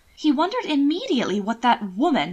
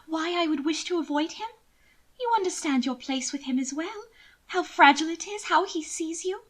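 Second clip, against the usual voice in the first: higher, pitying noblewoman; lightly raised lady's voice, sweetly condescending; Mina's voice; 8 semitones higher than usual. Acted. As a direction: coo over him with false pity. Narrator voices Mina.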